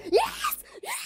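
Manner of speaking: shrieking yes in triumph; victory shout. Real, not acted. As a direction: scream yes after winning. Winning the move-in game.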